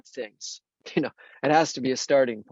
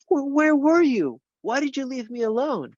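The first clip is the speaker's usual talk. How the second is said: higher, anguished plea to Jesus; pleading cry; 9 semitones higher than usual. Retold or imitated. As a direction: cry out like an abandoned monk. Anthony voiced pleading with Jesus.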